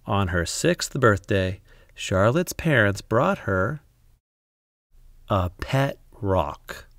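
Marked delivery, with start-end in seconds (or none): none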